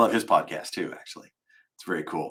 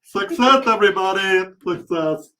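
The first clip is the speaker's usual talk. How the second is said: high, loud mock celebration; triumphant cheer; 9 semitones higher, 8 dB louder than usual. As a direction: announce victory to the whole room. Celebrating the fixed button.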